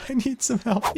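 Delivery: high, pleading cry for help; high whine. Real, not acted. Stuck in wall.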